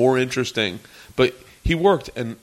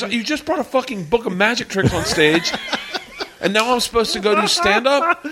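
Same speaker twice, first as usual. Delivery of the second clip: high-pitched, exasperated mock rant; imitated indignant complaint; 9 semitones higher than usual. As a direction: play an upstaged comic protesting. Retells Louis complaining about the tricks.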